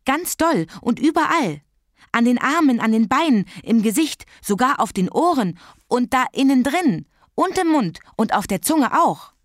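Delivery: put-on high, excited child listing every spot; breathless. A narrator's reading. Child telling her parents about Sarah's chickenpox, breathless and excited. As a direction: tumble out the gory details, pitch leaping. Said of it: "erzählte sie atemlos".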